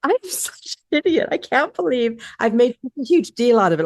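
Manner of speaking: high, self-mocking exasperation; self-scolding. Wife replaying her own thoughts after a fight, high and self-mocking. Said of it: "I'm like".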